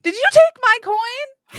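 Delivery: high squeal. Real, not acted. Player squeals, accusing friend.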